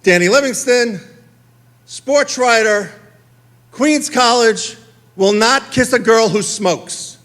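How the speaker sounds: slow mock-proud headline; reading the headline. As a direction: announce it like a big newspaper headline. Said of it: "it said".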